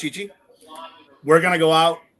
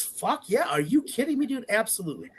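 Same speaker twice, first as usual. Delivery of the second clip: much higher, thrilled and disbelieving; excited approval; 7 semitones higher than usual. Retold or imitated. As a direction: burst out in eager yes. Friend eagerly agrees to proposal.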